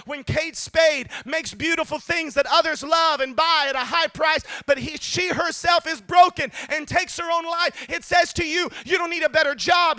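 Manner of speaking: high-pitched, insistent sermon delivery; climbing, urgent pulpit cry; impassioned preaching. Real, not acted. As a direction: drive each point home with a lifted voice. Preaching rising to full force.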